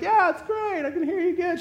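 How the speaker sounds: high-pitched mock cheerfulness; high eager reply. MRI patient reassuring the operator, high and eager. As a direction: answer her in a high, agreeable squeak.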